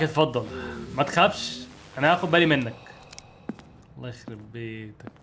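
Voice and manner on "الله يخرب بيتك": muttered under his breath, slow; quiet muttered curse. Real, not acted. Gamer alone with his screen, a slow muttered curse. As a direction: curse it softly to yourself. Muttering after tense game choice.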